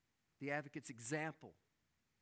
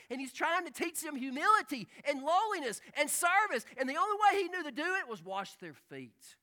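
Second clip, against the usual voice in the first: higher and louder, fast, climbing to a peak; preaching at full force; 10 semitones higher, 12 dB louder than usual. Acted. Preacher on the disciples' rivalry, rising to a fervent climax. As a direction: build the fervour line by line.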